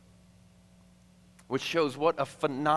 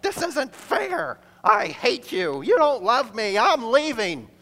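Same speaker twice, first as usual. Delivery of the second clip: put-on much higher, louder bratty child shout; bratty kid yelling; 12 semitones higher, 8 dB louder than usual. Grounded kid yelling at dad from the fish, bratty shout. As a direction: wail like a grounded kid throwing a tantrum. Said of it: "you'd assume they'd be shouting something like".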